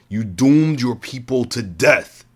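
a villain condemning a queen, shouting slowly; shouted accusation. A narrator's reading. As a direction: hurl the verdict at her. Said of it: "he shouted".

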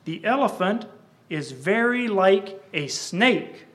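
slow, loud dramatic poem reading; poem recitation. Acted. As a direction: declaim the verses to the congregation. Recites the blind men poem.

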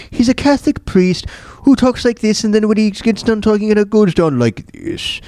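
a lightly voiced priest, pitched high, falling at the end; half-done high priest voice that drops off; priest voice demo. Shown as a demonstration. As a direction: sketch the priest's sinking downward inflection. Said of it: "the character kinda speaks with a downward inflection".

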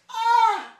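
high, loud, drawn-out 'ah'; hammed-up cry of pain; mock pained scream. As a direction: wail as if cut by paper. Acting cries at falling paper cuts.

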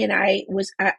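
usual speaking voice

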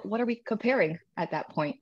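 ordinary delivery

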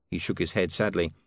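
ordinary delivery

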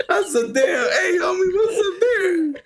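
high, slowed exclamation breaking into laughter; laughing call-out. Real, not acted. Friend reacting to what is shown, high laughing call-out. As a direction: call it out high while cracking up.

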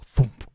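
loud, abrupt thud noise; imitated thump. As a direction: imitate a watermelon hitting the ground. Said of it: "it sounds like, you know, when you drop".

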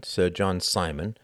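ordinary delivery

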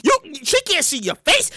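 ordinary talk, nothing marked

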